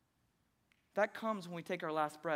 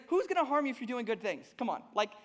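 loud, high-pitched sarcastic challenge; 8 semitones higher, 7 dB louder than usual. Retold or imitated. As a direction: play up how absurd the question is, raised and forceful. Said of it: "How do you not hear that sarcasm?".

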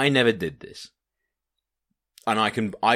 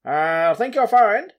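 much higher, louder and slow, with a put-on old statesman voice; Nelson Mandela impression; 13 semitones higher, 8 dB louder than usual. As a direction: drawl out "I think you're fine" in an imitated elder voice. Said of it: "That was definitely Nelson Mandela".

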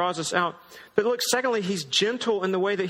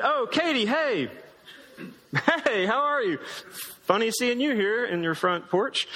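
high-pitched mock-surprised greeting at the start; hammed-up chance-meeting hello; feigned casual surprise; 3 semitones higher than usual. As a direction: pretend you just happened to bump into them. Feigned chance meeting with his crush.

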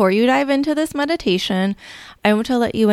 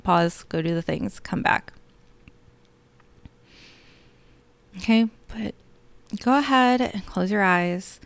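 guided meditation. Acted. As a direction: lull them gently into calm.

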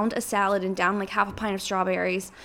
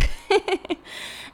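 airy unvoiced giggle; breathy giggle; 10 semitones higher than usual. Real, not acted. Laugh after her joke.

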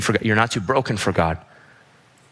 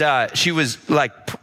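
normal talking voice

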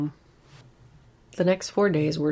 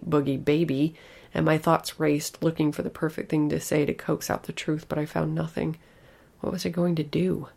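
ordinary delivery

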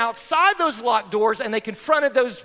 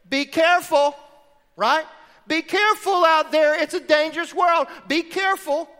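worried mother's warning, higher and louder; mom's warning; 7 semitones higher, 6 dB louder than usual. Retold or imitated. Mom warning her kid on the way to school. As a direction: fret like a mom at the door.

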